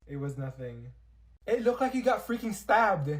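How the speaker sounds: usual speaking voice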